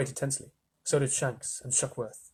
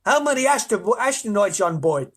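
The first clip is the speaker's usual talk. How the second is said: high, loud official demand; raised, imperious controller voice; ground control interrogating; 8 semitones higher, 6 dB louder than usual. A narrator's reading. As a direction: demand an answer from the crew.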